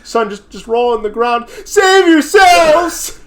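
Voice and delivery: building to very high, very slow shout; hammed-up fleeing officer; mock panicked cop. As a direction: end in a wild yell. Acting cops panicking.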